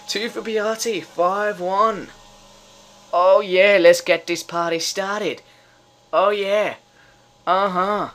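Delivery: high, loud party cheer; real gleeful whooping talk; jubilant celebration. Real, not acted. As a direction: crow it like the party is starting. Celebrates fifth goal.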